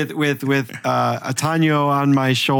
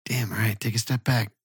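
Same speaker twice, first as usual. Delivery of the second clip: whisper; 3 semitones lower, 7 dB quieter than usual. Acted. Reacting to close whisper.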